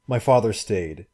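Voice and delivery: ordinary delivery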